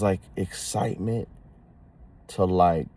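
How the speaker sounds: ordinary talk, nothing marked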